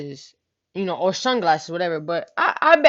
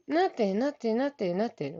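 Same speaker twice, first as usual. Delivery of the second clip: quiet denial; 2 semitones higher, 7 dB quieter than usual. Retold or imitated. Cousin denies again.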